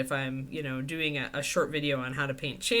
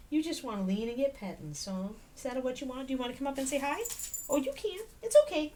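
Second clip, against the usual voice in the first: coaxing the dog; 8 semitones higher, faster than usual. Real, not acted. Owner coaxes her dog up, high and sweet. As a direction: sweet-talk her up onto your lap.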